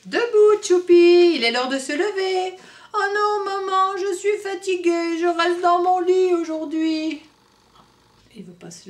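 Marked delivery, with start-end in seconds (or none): 0.0-2.6 loud, high wake-up call; mother calling. Acted. Mother waking Tchoupi in the morning, calling loudly. 2.9-7.2 put-on higher, slowed child voice, whining; a sleepy child begging to stay in bed; tired little boy. Tchoupi whining to maman that he's too tired.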